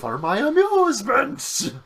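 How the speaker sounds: high, loud, drawn-out mock gloating; hammed-up villain. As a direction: play the gleeful killer, slow and loud. Answers the mock lament as killer.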